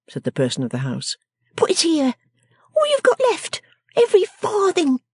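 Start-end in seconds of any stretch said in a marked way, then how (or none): 1.6-5.0 very high, commanding character voice; bossy little scold. Acted. Miss Wren demanding the wretched man's money, high and bossy. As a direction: order him about in a high, sharp little voice.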